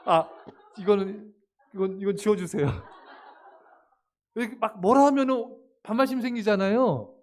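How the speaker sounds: ordinary talk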